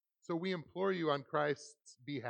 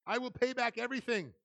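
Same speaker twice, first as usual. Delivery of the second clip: raised pitch, begging tone in a lightly voiced servant; pleading servant; 10 semitones higher, 4 dB louder than usual. A narrator's reading. Servant begging the master for time, pleading higher. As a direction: beg for mercy on your knees. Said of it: "he begged".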